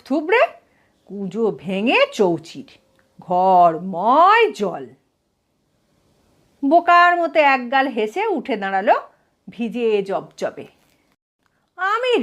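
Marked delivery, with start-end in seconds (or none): none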